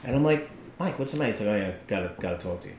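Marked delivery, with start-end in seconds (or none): 0.8-1.3 much softer, careful question; quiet concern. Retold or imitated. Asking a downcast friend gently. 1.5-2.7 very low, hushed and heavy; imitating downcast friend. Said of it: "looks like he just lost his best friend".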